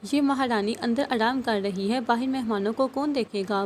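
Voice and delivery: ordinary delivery, nothing marked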